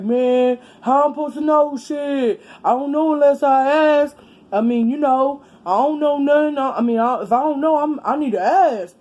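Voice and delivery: a hint of the husband getting worked up; defensive husband. Husband defends his question to wife and sister, loudly. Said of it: "He was like".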